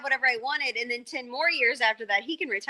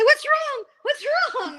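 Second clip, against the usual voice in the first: very high, mock-frantic dad calling out; panicked dad; 4 dB louder, slower than usual. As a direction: imitate a dad rushing out in alarm. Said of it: "he'd come running out and say".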